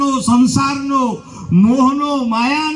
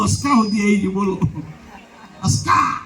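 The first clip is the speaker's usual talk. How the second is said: ordinary talk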